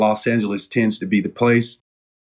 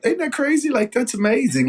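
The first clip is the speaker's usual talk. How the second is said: much higher, amazed outburst; excited disbelief; 13 semitones higher than usual. Real, not acted. Excited retelling of good luck.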